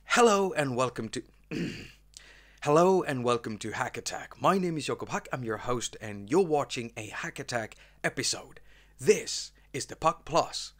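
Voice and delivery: upbeat show-host intro, like opening his channel; host intro read. Acted. YouTube host opening his episode, in an upbeat intro read. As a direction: greet the viewers as the show host.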